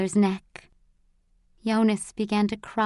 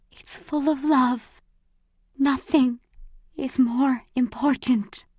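slow, high, breathy dying voice; weak, halting whimper; whimpering between breaths; 4 semitones higher than usual. A narrator's reading. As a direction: force out each phrase on failing breath. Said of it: "She whimpered between breaths".

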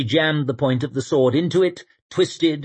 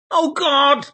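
dismayed man's voice; 4 dB louder than usual. A narrator's reading. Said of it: "he said again".

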